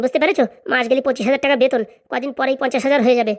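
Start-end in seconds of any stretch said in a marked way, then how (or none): none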